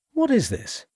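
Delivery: sudden high anxious question; alarmed, voice jumping up; a touch of panic in a high voice. A narrator's reading. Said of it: "Asked Armitage anxiously".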